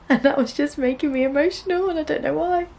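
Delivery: happy tears. Real, not acted. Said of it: "these are happy tears".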